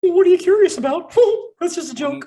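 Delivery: high cartoon squeak with a giggle; loud squeaky opening, then a lighter aside; squeaky gnome. Gnome shopkeeper joking with a customer, squeaky and giggling. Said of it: "why do you laugh like Mickey Mouse".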